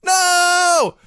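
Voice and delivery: high, loud wail of despair; hammed-up anguished scream. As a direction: cry out as if witnessing a murder. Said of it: "Crockett screams".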